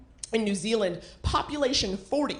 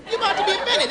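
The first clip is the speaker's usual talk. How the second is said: higher emphatic warning; 8 semitones higher, much faster than usual. Shown as a demonstration. Warning the audience about Swedes, higher and knowing. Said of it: "repeated line".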